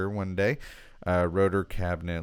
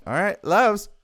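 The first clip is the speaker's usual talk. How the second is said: higher, louder bright call of loves; cheery greeting; 12 semitones higher, 8 dB louder than usual. Real, not acted. Vlogger greeting fans mid-video, cheery higher call. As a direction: beam it out to your fans.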